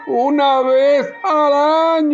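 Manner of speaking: high-pitched pupil voice; put-on high child's recitation; schoolboy. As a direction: recite the lesson like a little schoolboy. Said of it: "Pepito, ¿qué entendiste?".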